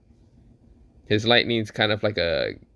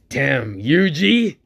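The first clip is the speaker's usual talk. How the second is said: drawn-out, high-pitched mock exclamation; Mahito's exclamation; 4 semitones higher, 4 dB louder, much slower than usual. Mahito reacts to Yuji in the fight, exclaiming. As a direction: stretch it out and swoop up high. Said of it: "This is where Mahito says".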